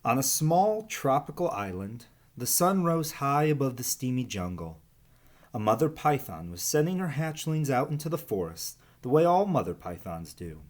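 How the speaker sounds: put-on reading a picture book to children; lively storybook narrator voice; story narration. A narrator's reading. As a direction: read it like story time for kids. Reads picture book aloud.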